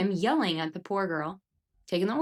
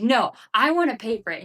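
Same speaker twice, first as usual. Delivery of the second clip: a mock indignant protest, higher and louder; imitating an angry man; 6 semitones higher, 5 dB louder than usual. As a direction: play the mad customer insisting on paying. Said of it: "And he's just so mad".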